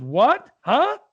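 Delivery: put-on a confused old lady who can't hear; deaf old lady. Old lady to husband at traffic stop, high confused. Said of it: "With accents and all".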